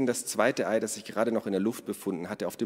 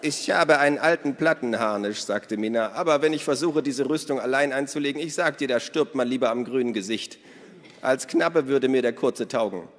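hohe, laute Stimme einer lebhaften Frau; Minnas Stimme; 5 semitones higher, 4 dB louder than usual. A narrator's reading. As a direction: Minna ruft die Gäste fröhlich zum Essen. Narrator voices Minna. (hohe, laute Frauenstimme)

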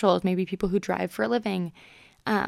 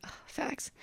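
whispered; 14 dB quieter than usual. Acted. Wry agreement to dad's quote.

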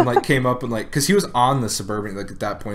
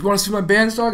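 put-on higher, louder drunk brag; mock drunk bragging; 10 semitones higher, 4 dB louder, faster than usual. Retelling the drunk guy boasting about his bands, higher mock brag. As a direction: swagger like a drunk showing off. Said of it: "He's like".

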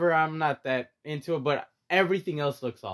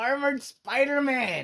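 higher, emphatic on ARMORED; excited insistence; 9 semitones higher, slower than usual. Real, not acted. Fan insisting on Armored Spider-Man, excited and emphatic. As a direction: push it like the final argument.

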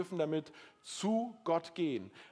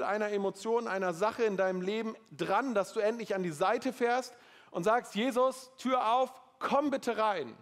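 higher, louder sermon push; fervent preaching; 5 semitones higher, 3 dB louder than usual. Acted. Preacher urges the congregation to invite Jesus in, fervently. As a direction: drive it home to the congregation.

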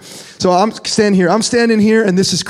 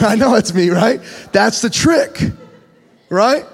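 normal talking voice

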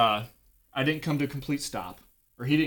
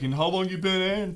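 higher-pitched mocking question; retold taunting; 7 semitones higher than usual. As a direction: needle him like a heckler. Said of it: "this dude's saying this shit to me".

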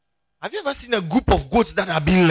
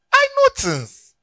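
much higher and slower, mock-knowing; squeaky boast; 6 dB louder, slower than usual. Preacher teasing the congregation with a high mock boast. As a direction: squeal it like a playful brag.

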